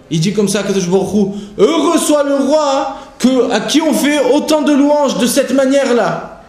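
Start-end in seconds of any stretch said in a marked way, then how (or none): 1.6-6.4 fervent recitation. Acted. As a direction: lift the words up like a blessing. Fervent rendering of God's words.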